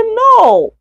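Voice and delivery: high, drawn-out acted "No"; emphatic denial. Student denies being seen returning yesterday, emphatic denial. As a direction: deny it with a high-pitched, stretched no. Role-played denial of claim.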